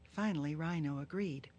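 plain reading voice

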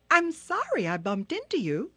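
high, apologetic little turtle; Turtle's voice. Acted. Small turtle excusing herself to Hippo, high and polite.

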